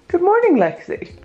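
high sing-song hello to the dog; high cooing good morning; pet voice greeting. Real, not acted.

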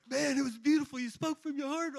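buddy's praise. Retold or imitated. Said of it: "my buddy goes".

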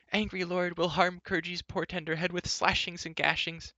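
high, whimpering creature voice; frightened creature pleading; Gurgi howling. A narrator's reading. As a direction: wail pitifully up high. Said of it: "howled Gurgi".

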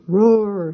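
loud mock roar; imitating the monster. Scooby shows friends the monster's roar, loud and mock. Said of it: "trying to imitate the snow monster".

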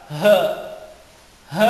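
H pushed up from deep in the stomach; heavy Arabic h from the belly. Shown as a demonstration. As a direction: drive the H from the gut. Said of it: "Parang nanggagaling siya sa sikmura".

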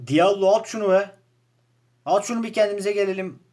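loud, high pleading yell at the player; frustrated shout. Real, not acted. Gamer yelling at his striker to shoot, loud and high. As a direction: shout at him to just shoot.

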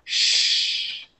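long, voiceless "Shh"; sh sound alone. Shown as a demonstration. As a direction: hold "Shh" as a steady hushing sound. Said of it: "es más S H".

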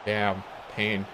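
normal talking voice

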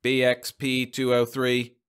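monotone loudspeaker voice, slow and even; mechanical drone. A narrator's reading. Monitoring machine announcing a violation code, droning mechanically. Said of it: "a voice promptly droned mechanically".

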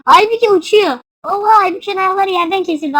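high, loud astonishment; amazed outcry. Real, not acted. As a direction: exclaim at an unbelievable sight. Sees player flying. (hayretle bağırarak)

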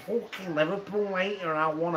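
mock Scouse rant, timbre put on; Liverpool accent. Shown as a demonstration. Mate showing off Liverpool voice, mock Scouse accent. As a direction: do a sweary Liverpool impression. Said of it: "Go on, do a Liverpool accent".

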